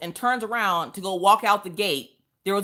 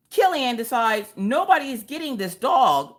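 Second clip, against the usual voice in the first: usual speaking voice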